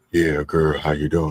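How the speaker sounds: low smooth-talker on the phone, mock. Man recalling calls to a girl back in the day, low put-on phone voice. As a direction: drop way down like sweet-talking a girl. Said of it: "your phone voice".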